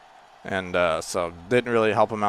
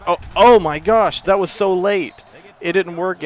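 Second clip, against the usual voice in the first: high, loud, drawn-out outcry; shocked dismay; 8 semitones higher, 7 dB louder than usual. Real, not acted. Gamer sees the pitch come too late, appalled. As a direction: cry out in disbelief at the botched play.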